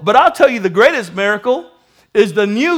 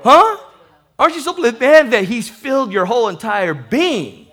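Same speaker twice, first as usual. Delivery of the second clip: usual speaking voice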